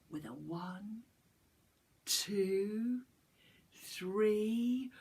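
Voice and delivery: ordinary delivery, nothing marked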